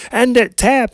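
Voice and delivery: high, loud desperate shout; dad shouting at son being choked, desperate; shouting tap. Retold or imitated. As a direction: yell at him to give up now. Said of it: "I'm like".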